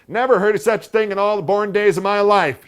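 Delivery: very high, loud indignant aunt; outraged rant on the street; aunt letting go. A narrator's reading. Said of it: "let herself go".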